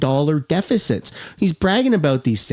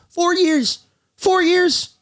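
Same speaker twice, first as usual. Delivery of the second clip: very high, incredulous repetition; exasperated disbelief; 11 semitones higher, slower than usual. Real, not acted. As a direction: push the pitch way up in disbelief. Exasperated at the waiting list length.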